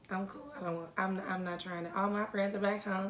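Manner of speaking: quietly waving off new friends; dismissive aside. Retold or imitated. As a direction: mumble it like you don't care. Retold dismissive inner voice.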